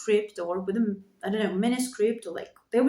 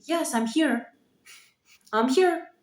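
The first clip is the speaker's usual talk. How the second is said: rising, higher and proud announcement; bold declaration; 3 semitones higher than usual. Retold or imitated. Declaring to the world she's here, a bit higher and bolder. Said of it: "be like".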